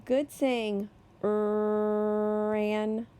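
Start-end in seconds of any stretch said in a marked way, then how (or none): 1.2-3.1 "Er" held long, then "an"; sounding out "Er, an" the slow way for a class. Shown as a demonstration. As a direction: stretch "Er, an" slowly, sound by sound. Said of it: "the slow way".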